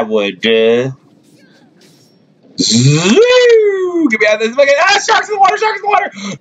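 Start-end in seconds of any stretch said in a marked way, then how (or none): 2.6-6.4 high frantic yelling; panicked shout to teammates; calling from far away. Real, not acted. Panicking in game, high yells.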